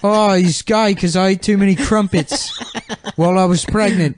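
bad put-on South African accent; mock South African accent. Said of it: "our version of the South African accent".

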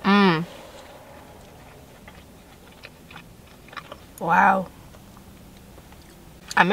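talking between bites; chewing pizza. Real, not acted. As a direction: mouth full of food. Seen 1: Bites at -3; cheeks full, chewing through "mm", "wow.

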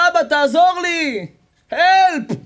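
loud, high cry for help; calling for help. Acted. Rabbi Yossi sinking, crying out for help, high and loud. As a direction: call out for help from the depths.

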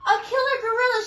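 high, loud terrified squeak; squeaky fright. A narrator's reading. Mouse telling koala who chases her. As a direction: squeak out the danger. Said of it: "she squeaked".

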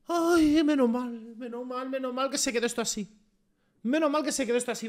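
high, sighing relief; huge relief. Real, not acted. Player seeing the score held, wailing with relief. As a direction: let the tension out in a high, drawn wail.

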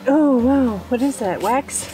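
usual speaking voice

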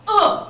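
heavy thud of an "uh" on impact; landing grunt. Shown as a demonstration. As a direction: grunt as you hit the ground. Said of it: "that's the land".

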